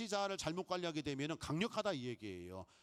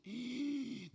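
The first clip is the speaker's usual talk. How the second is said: mock gasp; 7 semitones higher than usual. Amazed at too much money.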